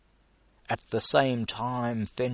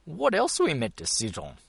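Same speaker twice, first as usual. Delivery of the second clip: high, fast indignant retort; hot indignation; 8 semitones higher, 3 dB louder, faster than usual. A narrator's reading. Col retorting hotly to Gus, quick indignant question. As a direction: snap back, offended. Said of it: "asked Col hotly".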